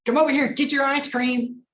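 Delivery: high, loud shrill-free yell across the shop; hammed-up yelling at a kid; mock yelling mother. Mother in ice cream shop yelling at her kid, mock shout. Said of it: "just yelling for him".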